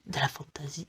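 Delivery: very soft, hushed whisper; murmured low under the breath; whispered aside. Real, not acted. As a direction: drop to a whisper, as an aside to yourself. Repeats the phrase hushed, aside.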